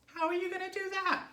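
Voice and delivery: very high, loud wife's voice; nagging wife voice. A narrator's reading. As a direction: question him in a high-pitched character voice. Wife's voice in story dialogue.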